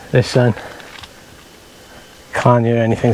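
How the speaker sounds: normal talking voice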